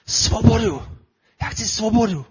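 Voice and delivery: impassioned re-enacted rebel's cry; roared quote. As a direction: call it out forcefully, pitched well above normal speech. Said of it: "tak zařval".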